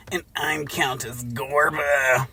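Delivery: sly countess. Acted. Countess introduces herself slyly.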